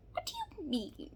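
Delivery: breathy whispered question; whispered disbelief. Real, not acted.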